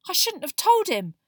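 high, loud, furious outburst; furious Harry. A narrator's reading. Boy regretting telling his secret, furious. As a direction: burst out in self-reproach. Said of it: "Harry said furiously".

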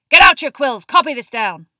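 loud, high classroom command; shouted order. A narrator's reading. Moody sets his class copying notes, shouting. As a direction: order the class to work at full volume.

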